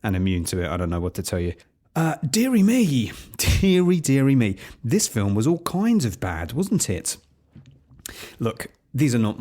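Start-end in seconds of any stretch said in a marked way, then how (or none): 2.0-4.6 loud, high-pitched, theatrical dismay; oh-dear dismay, played big; exasperated dismay. Real, not acted. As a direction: lament it with rising then falling swoops. Opens the review dismayed at film.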